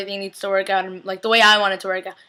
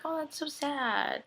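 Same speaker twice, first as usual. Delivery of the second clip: mock sad; 4 semitones higher, 16 dB quieter, slower than usual. Mock reaction to tombstone joke.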